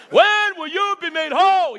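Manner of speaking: hint of his old father asking slowly; imitating his daddy. Preacher imitating his father's question to the church. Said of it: "I'm gonna say it like my daddy used to say it".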